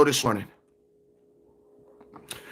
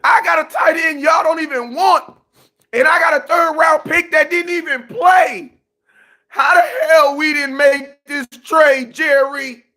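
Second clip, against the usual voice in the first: high, crying protest; mock tearful; 5 semitones higher than usual. Fan protests trade to team owner, mock-tearful wailing. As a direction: wail the grievance through hammed-up tears. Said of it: "brings me to tears".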